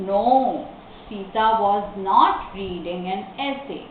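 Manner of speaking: slow answer, pause after "No"; careful, connected example sentence; model reading. Shown as a demonstration. Teacher models the negative answer, slow reading.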